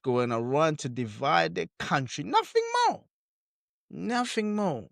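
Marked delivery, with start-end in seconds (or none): 2.2-3.0 "Nothing more" leapt up sharply in pitch, cutting the point off; emphatic final word. Real, not acted. Angry dismissal closing a rant. 3.9-4.9 "Nothing more" repeated softly, dropped down and settled; quiet echo. Real, not acted. Quiet echo after the outburst.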